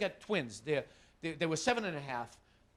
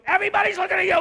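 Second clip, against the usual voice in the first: much higher, louder repeat; mock parental shaming; 12 semitones higher, 12 dB louder, faster than usual. Son shaming his mother in the supermarket, louder and higher. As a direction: crank it up to shame her in public.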